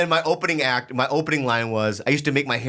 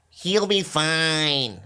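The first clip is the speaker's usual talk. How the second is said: quoting Mitzi; much slower than usual. Retold or imitated. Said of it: "That's what she said".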